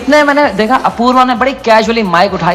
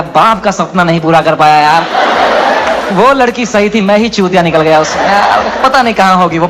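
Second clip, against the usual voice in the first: mock inner despair; 6 semitones lower than usual. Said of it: "अंदर चल रहा है".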